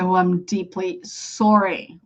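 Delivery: slow, low sympathy; deeply sorry. Acted. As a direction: offer heartfelt condolence, drawn out. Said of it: "When something is really bad".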